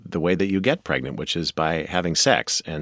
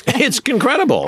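amazed; 6 dB louder than usual. Real, not acted. Amazed reaction to the taboo story.

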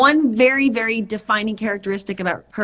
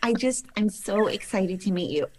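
soft lisping gush; breathily sweet, light lisp; Drew Barrymore lisp; 5 dB quieter than usual. Shown as a demonstration. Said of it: "Do Drew Barrymore talking to the dolphin".

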